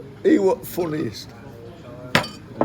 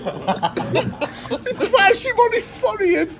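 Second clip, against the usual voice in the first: talking through high, helpless laughter; can barely get the words out for laughing; real laughter breaking up every word; 12 semitones higher than usual. Real, not acted. Telling the goat headbutt story.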